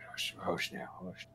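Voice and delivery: whispered, soothing hush; hushing father. Acted. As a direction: shush the kids very softly. Orc father hushing his kids.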